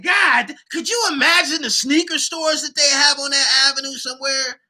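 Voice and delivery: thrilled wonder; excited. Retold or imitated.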